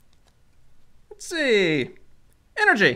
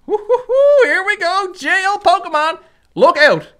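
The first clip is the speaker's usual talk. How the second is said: higher, louder whoop of joy; cheering; 5 semitones higher, 5 dB louder than usual. Real, not acted. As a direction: whoop it up like a winner. Celebrating the gold card.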